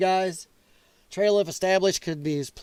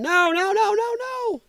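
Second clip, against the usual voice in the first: high whining protest; high whiny no; 11 semitones higher, slower than usual. Retold or imitated. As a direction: wail no like a panicked friend. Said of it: "Scott's up there going".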